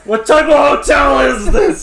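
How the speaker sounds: high, loud shout of disbelief; hammed-up appalled guest; mock outraged shout. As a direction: yell it indignant.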